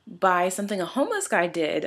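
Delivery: ordinary talk, nothing marked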